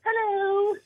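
very high, squeaky British falsetto; mock a British woman, Monty Python style. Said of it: "are Monty Python ... it's all women".

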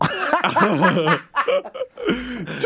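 big high burst of laughter. Real, not acted. Host laughs after fooling cohost, high and loud. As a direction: laugh hard at your own trick. Reveals prank.

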